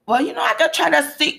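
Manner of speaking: high-pitched, self-mocking Jamaican accent impression; a rough Jamaican lilt, played for laughs. Quoting the gas station attendant in a Jamaican accent. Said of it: "this is my Jamaican accent".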